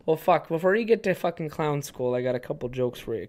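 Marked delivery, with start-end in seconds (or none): none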